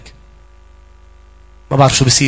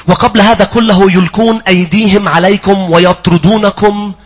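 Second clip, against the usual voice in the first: scripture reading; 5 semitones higher, 5 dB louder, slower than usual. Acted. Reads scripture aloud.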